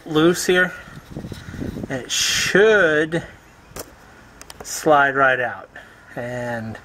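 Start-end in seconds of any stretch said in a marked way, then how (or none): 1.9-5.7 "should" stretched slow and leaned on, doubtful; stress on should. Shown as a demonstration. Mechanic doubting the part will come out easily, slow ironic stress on "should". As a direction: draw out "should" as if in air quotes. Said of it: "I emphasize the word should in finger quote".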